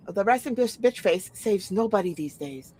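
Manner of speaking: ordinary talk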